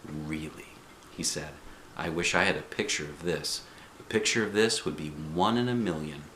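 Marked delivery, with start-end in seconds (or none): 0.0-0.7 much softer, lower murmur; quiet calm reply. A narrator's reading. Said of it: "real quiet and calm". 2.0-6.2 softer, steady and gentle; quiet calm instructions. A narrator's reading. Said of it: "real quiet and calm".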